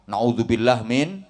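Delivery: ordinary talk, nothing marked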